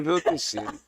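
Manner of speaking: ordinary delivery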